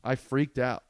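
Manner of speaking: ordinary delivery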